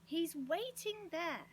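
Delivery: high, drawn-out shout. A narrator's reading. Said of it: "shouted Joe".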